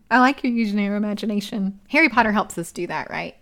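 ordinary talk

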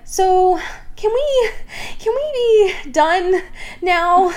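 high, drawn-out mock request; acted Jacob. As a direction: stretch it out high, asking to be let off. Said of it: "Jacob is like".

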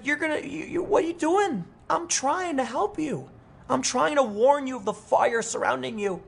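very high, strained mock pleading; exasperated plea. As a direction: plead in a high voice, like nobody will heed your warning. Acts out pleading with laughing Democrats.

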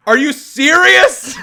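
high, loud, slow incredulous cry; are you serious, stretched out in outrage; outraged disbelief. Real, not acted. Outraged at lazy fortune.

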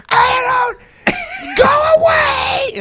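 very high, loud, slow sobbing yell; a shrieking whimper of go away; panicked crying shout. Retold or imitated. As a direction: shoo them away in tearful terror. Caught man panics, shooing them.

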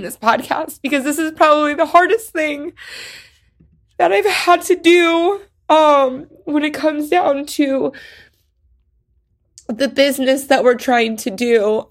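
fighting to keep from crying on mic; holding back tears. Real, not acted. Host alone on the podcast, fighting back tears. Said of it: "I'm trying not to cry while I'm recording".

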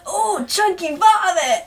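high, loud, drawn-out hammed-up yell; mock yell. Host imitating Carlos at the fake vomit, mock yell. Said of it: "Carlos yells".

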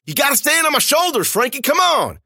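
high, loud pleading; desperate shout. A narrator's reading. Narrator begging Frankie to stand, loud and high. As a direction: beg her to stand, shouting.

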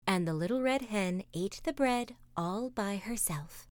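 ordinary delivery

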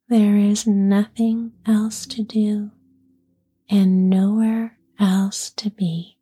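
slow, whispered relaxation guidance; guided meditation. Acted. Leads a relaxation exercise.

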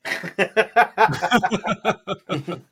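loud burst of laughing; laughter. Real, not acted. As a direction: laugh hard. Laughs at the joke.

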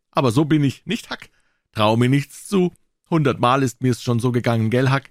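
put-on high-pitched boy's voice, showing off; high, cocky kid; boastful boy Tom, breezy. A narrator's reading. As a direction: play a boy bragging, pitched well up.

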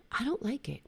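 a quiet, heavy-hearted admission; weary sigh. Real, not acted. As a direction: let the worry out on a breath. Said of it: "I'm not gonna lie".

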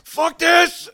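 high, loud furious snap; furious outburst. Acted. Frustrated elf yells in fury as he tears at the bra. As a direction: explode in rage. Elf loses patience at the door.

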